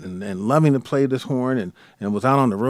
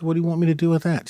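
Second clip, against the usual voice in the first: fast, higher puzzled question; quick puzzled; 5 semitones higher, much faster than usual. Retold or imitated. Son quickly puzzled by his mother's request. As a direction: rattle off the question. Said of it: "I said".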